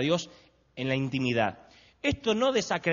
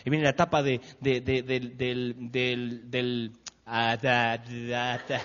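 stumbling; 5 semitones lower, much slower than usual. Real, not acted. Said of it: "Lo siento, lo volveré a intentar".

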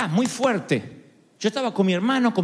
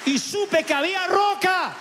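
slow, high testimony; 11 semitones higher than usual. Real, not acted. Preacher recalling the moment he found rock. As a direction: lift it slowly, with conviction.

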